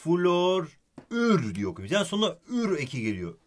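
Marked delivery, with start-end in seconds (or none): 0.0-1.9 "Florür" said slowly, rising at the end; slow demo. Shown as a demonstration. Teacher slowly models the reading florür for student. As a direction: stretch "Florür" slowly and rise on "ür".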